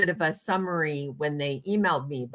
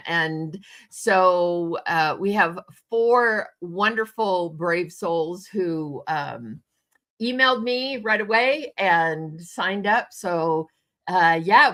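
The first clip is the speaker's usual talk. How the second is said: ordinary delivery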